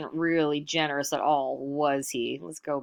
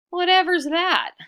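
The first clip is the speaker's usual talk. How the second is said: much higher, louder alarm; startled cry; 14 semitones higher, 10 dB louder than usual. Acted. Iktomi hears the boulder coming and cries out, startled. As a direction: jolt at the strange sound.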